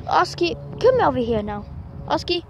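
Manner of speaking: loud, firm call; calling the dog. Real, not acted. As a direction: insist the dog come now.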